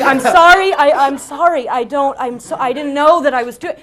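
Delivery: loud, high-pitched panicked pleading; frantic apology. Retold or imitated. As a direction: stammer out a desperate acted apology. Acts out frantic apology to roommate.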